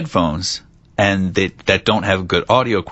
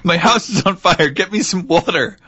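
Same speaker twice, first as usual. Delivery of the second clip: hammed-up cry for help, higher; mock panic; 9 semitones higher than usual. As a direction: pretend your house is burning. Mock emergency cry for water.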